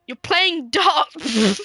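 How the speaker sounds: ordinary talk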